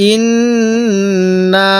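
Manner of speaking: ইন্না with the doubled n hummed long through the nose; nasal ghunna. Shown as a demonstration. Teacher shows nasal ghunna on ইন্না. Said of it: "নাকের বাসি দ্বারা গুণনা করব".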